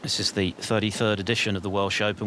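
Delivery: usual speaking voice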